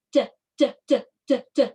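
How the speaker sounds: short, separate "d" taps; knocking letter sound. Shown as a demonstration. Teacher asking which letter this is, rapped-out "d" sounds. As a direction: repeat "d" like knocks on a door. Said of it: "like you're knocking on a door".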